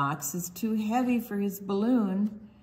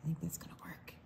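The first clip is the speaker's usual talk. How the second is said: soft whisper; 3 semitones lower, 12 dB quieter, much faster than usual. Pretend. Reader whispering to children beside the sleeping tiger. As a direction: whisper so she stays asleep.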